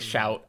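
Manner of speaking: "shout" with wide "ow" as in cow; American ou vowel. Shown as a demonstration. Showing friends their way, shout with open ow. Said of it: "You guys say shout".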